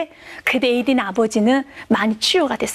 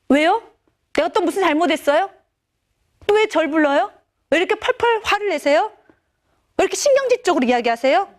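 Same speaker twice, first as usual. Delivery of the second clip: string of mildly indignant questions, pitched up; lightly acted teenage grumbling; defensive teens; 4 semitones higher than usual. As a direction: push back like a put-upon kid. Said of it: "아이들 먼저 이렇게 말을 해요".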